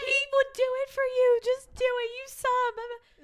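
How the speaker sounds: high, urgent, imploring voice; pleading. Acted. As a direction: convince them he deserves it. Voicing Feyre's plea.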